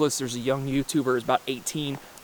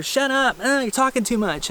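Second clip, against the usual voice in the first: high, snappy mock scold; a high mocking imitation of a bickering mother; bickering mom; 9 semitones higher than usual. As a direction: mimic a nagging mom shushing her family. Family haggling, voiced quote.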